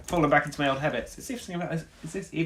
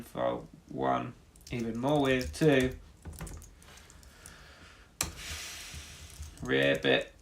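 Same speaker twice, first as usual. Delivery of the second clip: low frog voice; 3 semitones lower, 4 dB quieter than usual. Acted. Low sung frog part.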